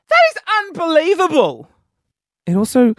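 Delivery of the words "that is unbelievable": high-pitched, emphatic amazement; amazed. Real, not acted. Marvelling at friend's thirty-two kilo loss, amazed.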